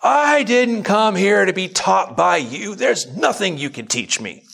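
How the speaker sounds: high, slow, indignant mock yell; imitating a visitor's angry outburst; retold yelling. A visitor yells at the pastor at his table, angry mock shout. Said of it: "Yelling at me".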